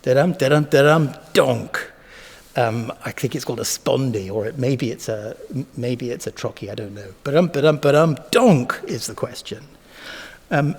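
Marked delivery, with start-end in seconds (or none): 0.0-1.9 steady da-dum then a punched donk; iambs then a thud. Shown as a demonstration. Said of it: "punctured the flow of iams". 7.2-9.7 ba-dum beats then donk hit hard; rhythm with a break. Shown as a demonstration. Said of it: "punctured the flow of iams".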